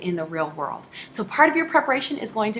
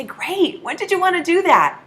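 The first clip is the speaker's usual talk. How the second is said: eager pitch; 10 semitones higher, 7 dB louder than usual. Acted. Upbeat caller booking a facial.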